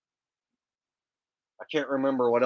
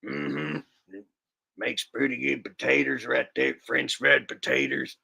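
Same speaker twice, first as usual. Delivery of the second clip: Sling Blade voice. Retold or imitated. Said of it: "do a better impression".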